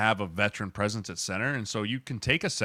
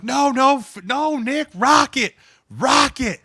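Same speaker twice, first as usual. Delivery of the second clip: very high, loud scream of the call; 9 dB louder, slower than usual. Retold or imitated. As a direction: scream him down, refusing to budge. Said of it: "they're just yelling at each other".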